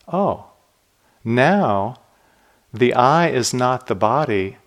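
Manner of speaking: ordinary delivery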